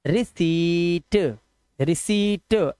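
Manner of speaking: रिसीट slowly as "ri-seeet", no p; long "ee", silent p. Shown as a demonstration. Teacher having learners read it, drawn-out रिसीट without p. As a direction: stretch the "see" of रिसीट and end on a light t. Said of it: "पढ़ो इसको".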